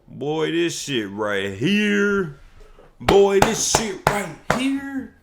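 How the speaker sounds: escalating excited yell; building hype shout. Real, not acted. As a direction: let the excitement explode. Hyped reaction to trailer news.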